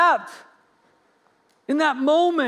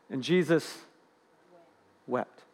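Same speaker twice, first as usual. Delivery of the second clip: hushed; 10 semitones lower, 6 dB quieter than usual. Acted. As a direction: drop almost to nothing on Jesus wept. Solemn climax of the story.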